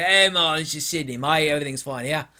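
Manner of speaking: a casual put-on Aussie reassurance; mock Aussie. Australians claiming it's just Sydney, light mock Australian accent. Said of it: "them Australians say".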